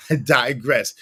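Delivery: usual speaking voice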